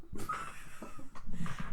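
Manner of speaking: high squealing laugh; shrieking laugh. Real, not acted.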